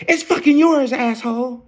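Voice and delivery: high-pitched imitation of her angry blowup; Tasmanian-devil outburst. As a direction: mimic an irate woman snapping way up high. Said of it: "She was just a fucking Tasmanian devil".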